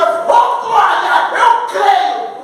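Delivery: high-pitched church exclamation of faith; fervent rejoicing. Acted. Believer answering a testimony, exultant and high. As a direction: cry out praise in mock congregation fervour.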